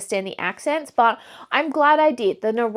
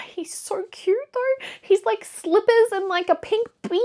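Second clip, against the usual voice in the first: much higher, gushing over something adorable; high, delighted gushing; 10 semitones higher than usual. Real, not acted. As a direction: coo about him like he's the sweetest thing. Gushing over a cute old character.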